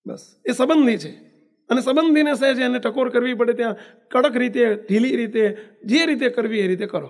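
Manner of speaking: ordinary talk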